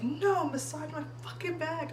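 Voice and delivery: high exasperated order; retold angry command; pissed demand. Wife in labor ordering husband, mock pissed. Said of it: "I was, like, pissed".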